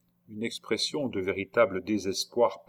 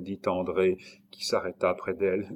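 usual speaking voice

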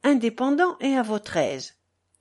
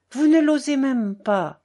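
louder, higher bitter sneer; bitter smile; 5 semitones higher, 5 dB louder than usual. A narrator's reading. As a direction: smile bitterly as you taunt. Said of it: "ajouta-t-il avec un sourire amer".

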